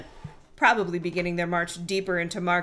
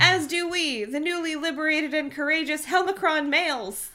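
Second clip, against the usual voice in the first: a little higher, proud declaration; lightly voiced alien male; 7 semitones higher than usual. A narrator's reading. Helmicron males joining in, lightly high proud voice. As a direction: proclaim your new freedom. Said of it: "came another voice".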